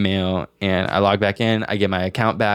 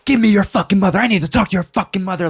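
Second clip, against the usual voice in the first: high-pitched, enraged mother yell; imitated screaming mom; 10 semitones higher, 4 dB louder, faster than usual. Mom demands the scammer kid's mother, screaming. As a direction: scream down the mic like a furious mom. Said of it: "starts screaming at this kid".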